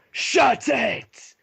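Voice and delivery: high, loud barked command; shout. Acted. Dwarf commander to the generals, a loud shout. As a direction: roar to silence the room.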